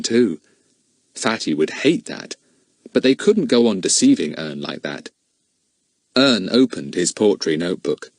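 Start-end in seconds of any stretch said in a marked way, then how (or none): none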